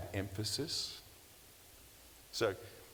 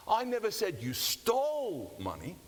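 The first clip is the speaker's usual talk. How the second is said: whole line higher and louder, "stole" hit with a rise; stress on STOLE; 11 semitones higher, 4 dB louder than usual. Shown as a demonstration. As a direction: push "stole" up and out, louder throughout.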